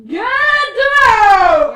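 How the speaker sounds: loud, high bellow; furious shout. Acted. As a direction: throw him out with a roar. Priest throws him out.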